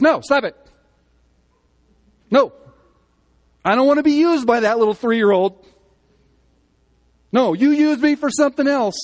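high, loud, shrill-free panicked scream of a dish. Acted. Preacher voices a screaming dish refusing the three-year-old. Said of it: "and it screams out".

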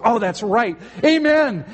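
high, urgent sudden recollection; panicked. Acted. Rider near the cliff remembering the stop word, panicked. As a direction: blurt it high, climbing to an urgent call. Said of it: "the man in panic is saying".

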